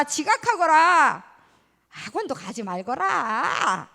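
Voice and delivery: hammed-up parent begging child to misbehave; reverse nagging parent. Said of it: "이런 부모님 있어요?". (장난스럽게 과장한 거꾸로 잔소리)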